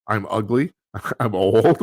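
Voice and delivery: normal talking voice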